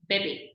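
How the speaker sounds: "bébé" short and light, "bay-bay"; slight A sound. Shown as a demonstration. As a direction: keep both vowels of "bébé" brief. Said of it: "just pronouncing a slight A sound".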